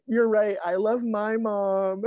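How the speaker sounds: high-pitched tearful wail, drawn out at the end; mock crying. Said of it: "I cried at that moment".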